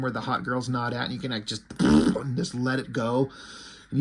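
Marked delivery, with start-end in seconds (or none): 1.7-2.2 long blown raspberry; imitated release noise. Shown as a demonstration. Showing how built-up gas gets released, sputtering raspberry. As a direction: blow out a low sputtering puff.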